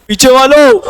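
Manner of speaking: lightly mock the teacher's call; imitating Mittal sir. Teacher calling the back benches, lightly imitated. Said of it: "Mittal sir ऐसे ही बोलते ना".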